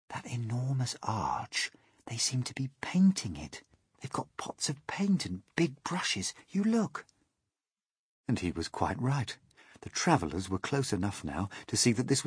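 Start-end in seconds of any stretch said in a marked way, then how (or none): 0.1-7.1 a hushed whisper, softer. Acted. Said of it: "James whispered".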